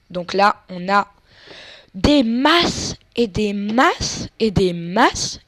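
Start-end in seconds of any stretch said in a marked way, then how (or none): 1.9-5.4 slow, stretched words of glee; gloating drawl. Real, not acted. Gamer gloating at piles of cash, slow and stretched. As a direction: savour every word of the loot.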